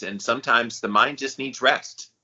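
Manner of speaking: ordinary talk, nothing marked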